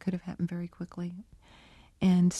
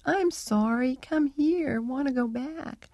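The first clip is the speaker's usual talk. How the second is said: higher, plaintive imitation of a pleading parrot; pitiful little voice; 6 semitones higher, slower than usual. Researcher imitating her parrot left at the hospital, pitiful. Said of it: "he says in this pitiful little voice".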